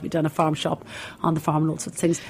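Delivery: usual speaking voice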